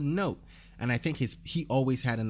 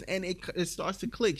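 usual speaking voice

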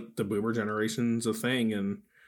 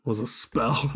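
normal talking voice

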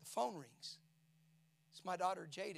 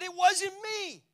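anguished cry; 12 semitones higher, 15 dB louder, much slower than usual. Retold or imitated. Father answers his wife.

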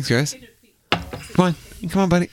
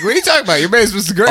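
mock disbelief; 6 semitones higher, faster than usual. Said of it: "Everybody's just like".

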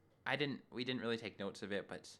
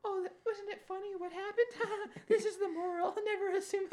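very high, squeaky laugh-talk; mock laughing squeal; 6 dB louder than usual. Everyone stating the moral, mock laughing squeal. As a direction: chortle the words in a hammed-up squeal. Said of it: "they're all talking like".

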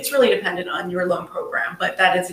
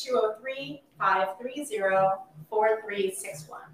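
slower, each digit spelled out; over enunciating; 8 dB quieter than usual. Shown as a demonstration. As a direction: read the number carefully, digit by digit. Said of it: "slowly and loudly".

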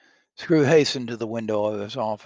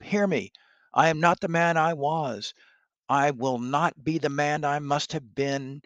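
higher pleading, stressing not; desperate pleading; 6 semitones higher, 4 dB louder than usual. Acted. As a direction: plead with the spirit to hear him. Scrooge pleads to the spirit.

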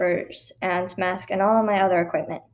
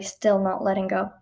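plain reading voice

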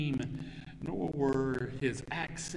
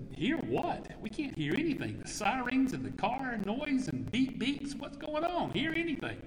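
a higher, disbelieving friend; incredulous buddy; 7 semitones higher than usual. Acted. Friend in the noisy street asks what he hears, incredulously. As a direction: ask it like he's baffled.

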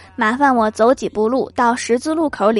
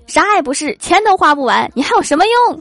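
mock angry dad, climbing higher; dad losing temper; 6 semitones higher, 4 dB louder than usual. Dad angry his son won't spend money, mock rage. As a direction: flare up like an exasperated father. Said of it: "我爸突然就急眼了".

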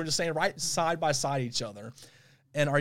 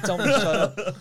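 laughed through, louder; laughing; 5 semitones higher, 6 dB louder than usual. Real, not acted. Retelling embarrassing camp moment, laughing. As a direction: laugh the line out.

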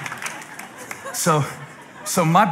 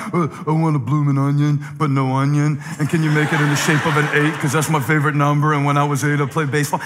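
lower, dopey voice for a picky diner ordering; mock Chunks ordering, pitched down; imitating a fussy customer; 4 semitones lower, faster than usual. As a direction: play the demanding restaurant regular, lower. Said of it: "he'll walk in a restaurant".

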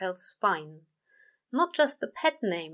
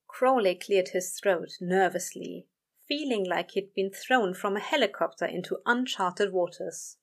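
ordinary delivery, nothing marked

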